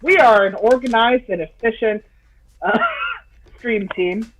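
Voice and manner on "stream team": ordinary delivery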